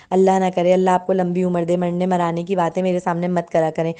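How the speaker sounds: usual speaking voice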